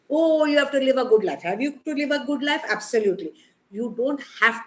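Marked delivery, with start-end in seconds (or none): 0.1-1.4 high, loud sarcastic echo of the church; hammed-up preachy quote; mocking church demand. Quoting what churches demand. 1.4-2.6 sceptical question. Real, not acted. Questions the church demand.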